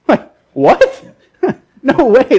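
high, loud, incredulous outburst; scoffing with a laugh; laughing disbelief. Real, not acted. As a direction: burst out amused at the absurdity. Scoffs at the imagined scene.

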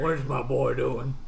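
slow, lightly voiced bewildered father; a hint of a puzzled dad. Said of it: "I don't know how your dad talks".

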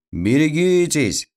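high-pitched storybook cry; mock warning shout. A narrator's reading. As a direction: call out a warning, lightly acted. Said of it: "крикнул Мишка".

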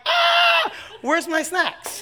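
very high, loud comic shriek; mock panicked scream. Speaker replaying their own panic about snacks, mock scream. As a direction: scream it, then wail the question slowly.

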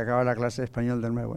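low, soft, quick student aside; mock a student's muttered reaction; imagined student reaction. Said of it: "uy, dice usted". (alumno imaginario, bajo y rápido)

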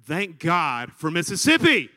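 slowed, loud, through a laugh; laughing punchline. Retold or imitated. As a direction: deliver it grinning. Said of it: "y'all all might be doing this".

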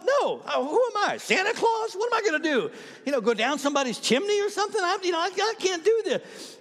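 high, loud, frantic retelling of balking; acted panicked protest. Skydiver balking at jumping over neighborhoods, acted panic. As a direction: balk in a high, strained voice, mock-panicked.

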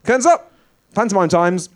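high, loud curtain call. Acted. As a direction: shout it to open the show. Announcing start of the panto.

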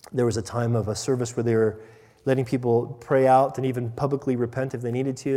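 usual speaking voice

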